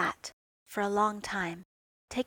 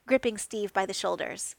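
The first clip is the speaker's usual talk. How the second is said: plain reading voice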